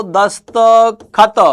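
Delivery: दस्तखत said very slowly, each syllable clear; slow careful sounding out. Shown as a demonstration. Teacher sounding out दस्तखत slowly for students. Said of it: "ये दस्तखत नहीं".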